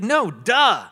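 emphatic correction, "duh" with d for "th"; child's insistent mispronunciation. Retold or imitated. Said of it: "his enunciation and pronunciation has not caught".

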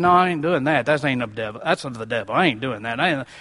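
imagined refusal in a low grumble; balking refusal. Retold or imitated. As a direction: drop your voice low to imitate someone refusing. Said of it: "if Jesus said".

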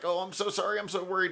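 mock worried apology, high-pitched; imitating nervous apology. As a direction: play a flustered apologizer lightly. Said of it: "super nervous".

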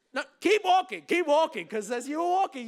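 high, loud mock command; urgent urging. Walker to a partner who stops, loud urging. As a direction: call out to keep them moving.

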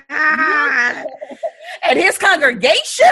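preacher calling out, slow and loud; slow hammed-up sermon shout with a laugh; preacher imitation, half laughing; mock preacher shout. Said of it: "They be like".

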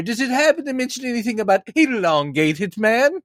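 high, polite fanboy questioner; impression of himself. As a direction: raise hand and ask, pitched high. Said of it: "Is that a good impression of me?".

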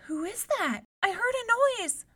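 high, loud, scared princess; frightened cry. A narrator's reading. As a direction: cry out at the snapping sound. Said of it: "she cried".

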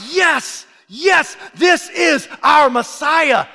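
slow shouted cheer, high and loud; joyful crowd shout. Retold or imitated. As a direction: cheer like a crowd welcoming a king. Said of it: "They were like".

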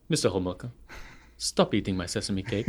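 softly, calmly threatening; low quiet warning before the yell; understated quiet menace. Retold or imitated. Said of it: "looks at him and says".

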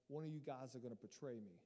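low, soft attempt at an Italian mobster; half-hearted Italian mob boss; botched Italian mob voice. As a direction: try an Italian mafia accent and not quite get it. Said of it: "I can't do that Italian mob voice".

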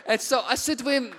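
ordinary talk

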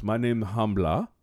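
lightly imitated Chadian accent. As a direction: introduce yourself as a Chadian man in broken English. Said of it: "he hands me his card and he goes".

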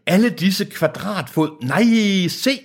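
high, theatrical awe; acted amazement. A narrator's reading. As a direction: re-enact high-pitched wonder. Re-reads Deacon's amazement.

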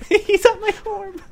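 very high, slow squeaky voice; squeaky elf voice. Shown as a demonstration. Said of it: "Tweety Bird and the Keebler Elves".

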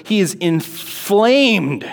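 he is inflamed, stretched out slowly; slow emphatic stress. Shown as a demonstration. Teacher stressing Jonah's rage, slow and emphatic. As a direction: lean hard on inflamed. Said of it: "he is so angry right now".

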